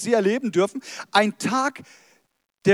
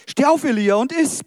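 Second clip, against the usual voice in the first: raised, louder angelic command; 7 semitones higher, 7 dB louder than usual. Acted. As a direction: order him up, voice lifted high. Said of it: "befahl er ihm noch einmal". (befehlend, mit angehobener Stimme)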